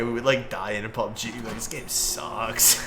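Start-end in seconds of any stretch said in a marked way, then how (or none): 1.5-2.8 slow, stretched "This game sucks" in full voice; drawn-out groan. Retold or imitated. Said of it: "the number one thing me and Kazi would say".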